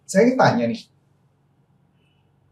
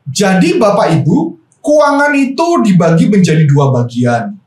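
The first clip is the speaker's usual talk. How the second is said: stiff stage presenter; 4 semitones higher than usual. Retold or imitated. Coach imitating a senior colleague's stiff stage delivery. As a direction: boom it out like a stiff stage speaker. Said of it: "ngomongnya seakan-akan berubah intonasi".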